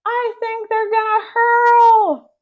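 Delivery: high, loud, slow alarmed yell; boy yelling in disgusted panic; panicked yell. A narrator's reading. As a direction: shriek the warning as they gag. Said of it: "cried Harold".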